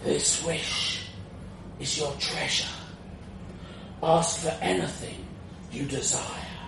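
otherworldly spirit granting a wish; ethereal dragon ghost. A narrator's reading. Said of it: "an ethereal ghost of the dragon".

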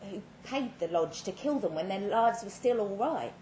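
ordinary delivery, nothing marked

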